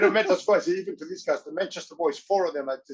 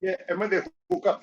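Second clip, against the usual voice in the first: usual speaking voice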